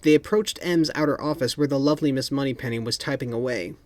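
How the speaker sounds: ordinary delivery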